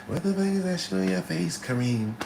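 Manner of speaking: put-on high, scolding girlfriend; mock the nagging girlfriend; lightly voiced angry girlfriend. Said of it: "your bitch is like".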